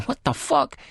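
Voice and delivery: sudden high-pitched disbelief; shocked outcry. A narrator's reading. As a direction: exclaim it high, appalled. Woman's shocked reaction, acted.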